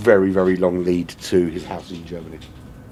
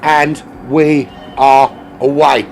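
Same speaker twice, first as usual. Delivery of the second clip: excited, higher-pitched sim racing commentary; live race play-by-play; 10 semitones higher, 5 dB louder than usual. Real, not acted. Streamer calling the lagging racer off the line, excited race commentary. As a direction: call the race start with rising urgency.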